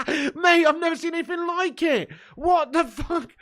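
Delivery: high loud laughter, words breaking through it; laughing disbelief. Real, not acted. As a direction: laugh hard while barely getting the words out. Still laughing at the clip.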